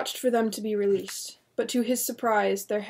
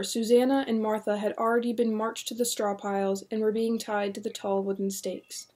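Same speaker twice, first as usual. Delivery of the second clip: usual speaking voice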